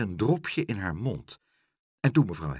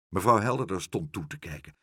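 plain reading voice

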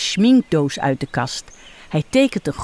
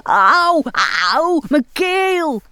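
drawn-out, higher pained wail; mock roaring in pain; 7 semitones higher, slower than usual. A narrator's reading. Sick clown roars about his sore throat, hammed-up wail. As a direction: ham up a sore-throat howl. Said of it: "En dan begint hij te brullen".

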